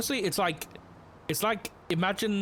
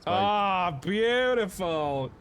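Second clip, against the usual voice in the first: drawn-out praise; 5 semitones higher, 3 dB louder, much slower than usual. Real, not acted. Spike lands on car.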